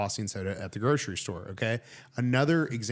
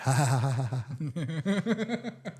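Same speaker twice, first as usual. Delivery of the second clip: long stuttering laugh, climbing higher; Michael Scott; 5 semitones higher than usual. Retold or imitated. Imitating a soundboard effect, exaggerated rising laugh. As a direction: cackle in a rising, put-on comic laugh. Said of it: "Maybe the Michael Scott".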